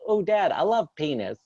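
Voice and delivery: lightly voiced lisping six-year-old; imitating little daughter. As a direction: kid proudly announcing a snack. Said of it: "She's like".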